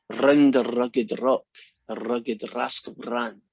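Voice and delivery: slow, with every R in "rugged" and "rascal" rolled. Shown as a demonstration. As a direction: roll each R slowly through the rhyme. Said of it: "it goes something like this".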